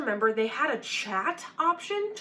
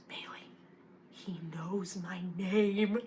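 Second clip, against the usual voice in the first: slow, hushed mock-horrified whisper; shocked whisper; 4 semitones lower, 8 dB quieter, much slower than usual. Spooked brother to his sister on the plane, shocked whisper.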